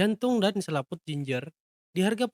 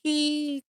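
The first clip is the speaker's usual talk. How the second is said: high-pitched drawn-out squeal; goblin squeak; 9 semitones higher than usual. A narrator's reading. Confused goblin clinging along, squeaking high. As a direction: squeak like a puzzled little creature. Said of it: "Dengan bersuara".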